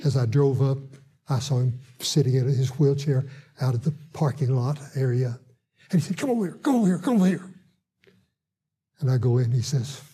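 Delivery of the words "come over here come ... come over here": retold eager beckoning. Man in wheelchair calls the preacher, eager beckoning. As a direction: imitate someone eagerly waving a friend over.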